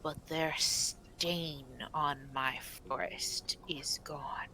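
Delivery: slow, hushed guardian voice, sinking into whisper; soft, halting whisper of an old forest spirit; whispering stag spirit. Acted. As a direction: breathe out each phrase slowly and solemnly. Game master voices the stag spirit.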